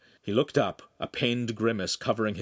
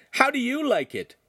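high-pitched, louder jeer; gleeful taunt; 5 dB louder than usual. A narrator's reading. Lincoln taunts the suffering Podesta, gleeful. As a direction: throw it back in his face. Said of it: "Lincoln laughed".

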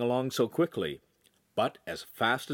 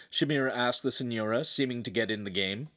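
ordinary delivery

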